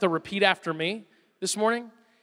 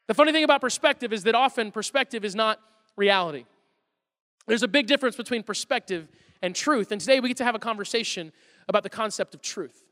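ordinary talk

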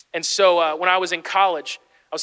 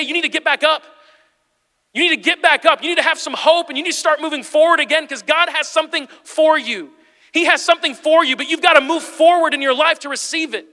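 loud, high, urgent exhortation; rally the congregation with punched phrases; impassioned pulpit urging; full-force preaching; 10 semitones higher, 7 dB louder than usual. Real, not acted.

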